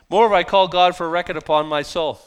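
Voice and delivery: loud, declaiming scripture reading. Acted. Preacher to congregation, loud scripture reading. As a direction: proclaim the verse to the church.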